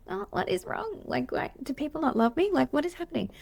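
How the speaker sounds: high, plaintive mock dismay; mock hurt. Therapist joking about her colleague getting presents, mock-wounded high voice. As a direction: play up being unloved in a high, small voice.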